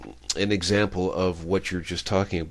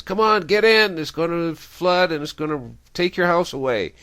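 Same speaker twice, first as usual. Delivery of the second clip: high, loud call from a boat; acted shouting to someone far off; urgent shout; 10 semitones higher, 7 dB louder than usual. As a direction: holler an urgent warning, pitched well up. Boatman calling a warning to old man.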